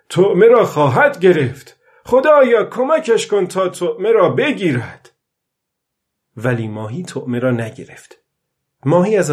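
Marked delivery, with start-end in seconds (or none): 0.1-5.1 put-on higher, louder hopeful old man; said aloud, hopeful. Old man hoping aloud the fish bites, loud and high. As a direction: cry out a hopeful prayer. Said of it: "پیرمرد با صدای بلند گفت".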